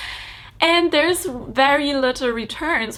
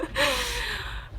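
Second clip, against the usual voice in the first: teary laugh; 10 dB quieter than usual. Real, not acted. Emotional relief, laughing while teary.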